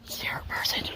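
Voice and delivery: unvoiced whisper aside. Real, not acted. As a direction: whisper it so she won't hear. Secret remark about a stranger.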